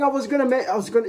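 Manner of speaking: very high, hurried squeal; high-pitched mimicry. Acts out looking at saved money.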